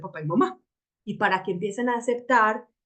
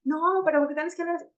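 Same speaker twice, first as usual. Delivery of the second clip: mock shrill scolding; 8 semitones higher than usual. Parodying the defensive reply to someone yelling, shrill and heated. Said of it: "que el solo hecho de decirle".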